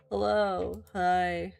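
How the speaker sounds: ordinary talk, nothing marked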